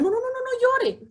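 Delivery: high, fussing no no no; high, anxious shushing of a crier; fussy hushing. Retold or imitated. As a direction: flap at them to stop crying. Quoting people hushing a crier.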